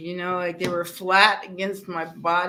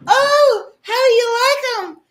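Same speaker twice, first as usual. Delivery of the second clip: loud show-off squeal; 9 dB louder than usual. Retold or imitated. Woman showing off her new chest, loud high squeal. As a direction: belt it out high like a proud reveal. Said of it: "she goes".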